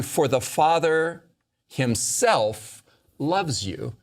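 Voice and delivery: slow, weighted "himself", set off by a pause; stress on HIMSELF. Real, not acted. Teacher reading Jesus' words about the Father, stressing "himself". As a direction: draw out "himself" before "loves you". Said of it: "I'm emphasizing the word himself".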